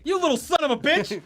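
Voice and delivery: yelled high in mock fury; mock angry shout. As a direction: bark it like a cheated victim. Mock outrage at thieving robot.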